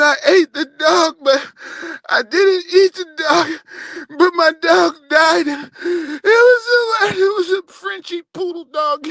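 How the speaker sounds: imitating Janet's tearful confession; mock sobbing. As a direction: blubber it out in fake tears. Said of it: "He said".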